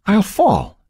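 protesting. A narrator's reading. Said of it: "Becky protested".

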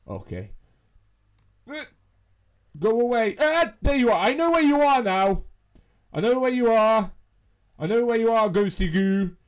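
ordinary talk, nothing marked

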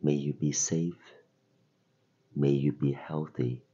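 normal talking voice

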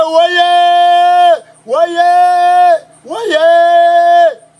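high, loud held cry on one note; drawn-out shout. Acted. Preacher crying out mid-sermon, long high shouts. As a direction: call it out long and high, each cry sustained.

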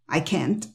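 CAN'T with the T clearly released; soft "I can't", audible T burst; exploded final T. Shown as a demonstration. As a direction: pop the T at the end of CAN'T. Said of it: "ahí exploté la T".

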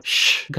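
a sharp hushing sound; mother shushing her child; whispered shush. Acted. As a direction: hush the child with a breathy shh. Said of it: "హెచ్చరించడం".

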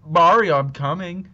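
rising, breaking loud moans; climax moaning. Acted. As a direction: build to a loud, high cry. Acting Luigi's climax line.

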